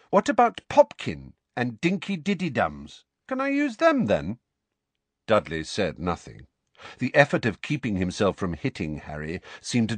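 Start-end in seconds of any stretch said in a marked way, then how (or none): 0.1-4.4 very high, teasing questions; goading lilt, soaring in pitch; mocking taunt. A narrator's reading. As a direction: needle him with mock innocence. Goading cousin with pet names.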